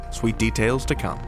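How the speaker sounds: ordinary talk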